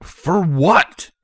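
loud, high startled question; blurted out. A narrator's reading. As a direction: blurt it out, voice jumping up. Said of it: "Broadsword blurted out".

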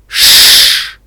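loud, sharp shush. A narrator's reading. Pinkie hushes Little Pip, sharp shushing. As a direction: hush someone forcefully.

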